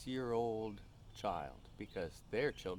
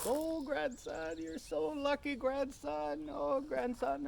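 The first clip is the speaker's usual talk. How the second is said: very high, drawn-out tearful wail; mock-sobbing high old lady voice; crying drunk grandmothers; slower than usual. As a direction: imitate weepy grannies gushing over a grandson. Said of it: "they'd cry and they'd hold on to me".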